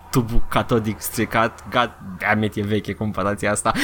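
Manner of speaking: low, measured intro narration; low mock-solemn announcer with pauses; ominous show narrator. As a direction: intone a spooky TV-show introduction. Parody of eerie TV intro narrator.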